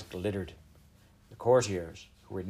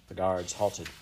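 ordinary delivery, nothing marked

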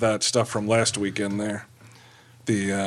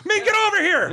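calling out. Real, not acted. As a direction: shout him over, laughing. Calling a friend over.

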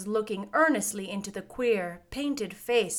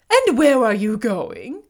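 scarecrow voice; 9 dB louder than usual. A narrator's reading.

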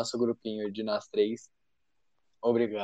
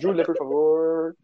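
slow, loud, ceremonious call; announcer voice; 5 semitones higher, 5 dB louder, slower than usual. Acted. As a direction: introduce her like a host. Anuncia a vez da Júlia. (chamando com pompa de apresentador)

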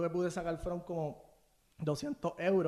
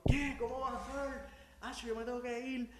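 shocked disbelief; 7 semitones higher, 7 dB quieter than usual. Retold or imitated. Retold shock at missing flight.

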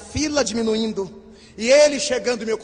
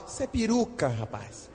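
confiding aside; 2 semitones lower, 10 dB quieter than usual. Retold or imitated. Confiding secret about her wig.

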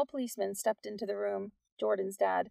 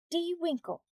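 high, curt reply; 9 semitones higher than usual. A narrator's reading. Teacher giving the policeman her name, curt. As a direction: give the name curtly, pitched up. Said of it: "she answered curtly".

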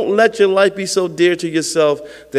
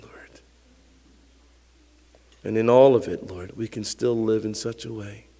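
congregational prayer; 2 semitones lower than usual. Real, not acted. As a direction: pray earnestly, settling from full voice into softness. Leads the closing prayer.

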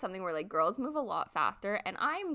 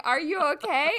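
a mild mock yell, louder and higher; retold yell; 6 semitones higher, 6 dB louder than usual. Retelling how she yelled at the trapped ex, mock yell. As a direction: call out the question as if across the yard. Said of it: "I'm literally yelling".

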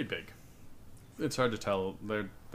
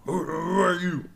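loud dim ogre asking; booming, simple-minded giant; 7 semitones higher, 8 dB louder than usual. Acted. GM voices ogre dialogue.

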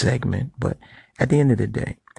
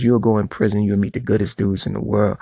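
ordinary talk

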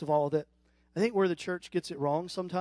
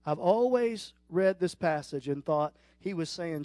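ordinary talk

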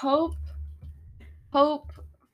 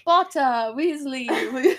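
louder British attempt; British accent; 5 dB louder than usual. Shown as a demonstration. Retrying the teacher's scolding, British accent. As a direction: another go at a stern British accent. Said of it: "trying to do a British accent".

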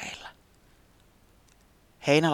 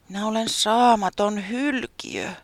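higher, slow self-scolding; self-reproach; 9 semitones higher, 3 dB louder, slower than usual. Acted. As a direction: berate yourself slowly. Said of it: "se soimasi itseään".

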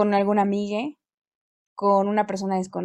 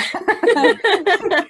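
laughing after spit take; 10 semitones higher, 6 dB louder than usual. Real, not acted. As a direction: burst of high laughter. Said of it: "Escupí el agua".

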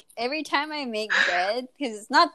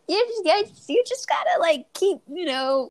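mock encouraging friend; 8 semitones higher than usual. Bernanda's encouragement quoted.

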